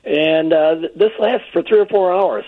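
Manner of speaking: ordinary delivery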